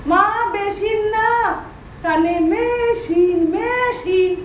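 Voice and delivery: কানে machine said very slowly and deliberately; slow over-clear repeat. Acted. Daughter-in-law slowly repeats to her deaf mother-in-law. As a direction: spell out কানে machine word by word. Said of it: "spelling it out to deaf mother".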